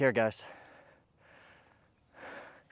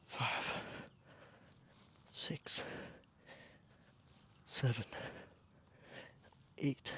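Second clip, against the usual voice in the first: counting workout reps; 3 semitones higher, slower than usual. Real, not acted. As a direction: count out each rep while pushing through. Counting reps during push-ups.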